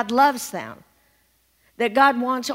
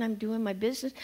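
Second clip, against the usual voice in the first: ordinary delivery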